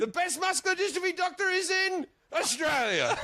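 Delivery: very high put-on exclamation; hammed-up plot line. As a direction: exclaim the absurd excuse way up high. Acting out far-fetched film plot.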